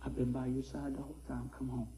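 hushed, low voice of God; soft retold whisper from the Lord. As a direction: murmur it close, very softly. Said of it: "Lord whispered".

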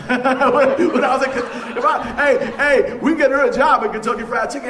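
loud, high, laughing through the words; laughing shout. Real, not acted. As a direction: crack up while shouting out the joke. Joking about the bird business.

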